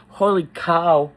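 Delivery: ordinary delivery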